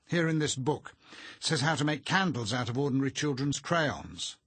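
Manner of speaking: loud, high, determinedly chatty; valiantly entertaining. A narrator's reading. Arthur reading aloud to the doctor, valiantly entertaining. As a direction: try hard to keep the company entertained. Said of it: "in the manner of one endeavouring valiantly to entertain".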